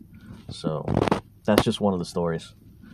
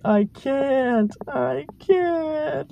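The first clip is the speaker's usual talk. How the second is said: very high, squeaky mock-strained voice; imitating girlfriend straining; much slower than usual. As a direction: squeak it out like she's stuck on the couch. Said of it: "struggling so hard".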